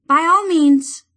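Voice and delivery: agree, but firmly; agreeing druid. Acted. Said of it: "she agreed".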